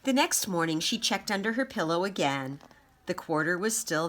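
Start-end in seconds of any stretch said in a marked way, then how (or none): none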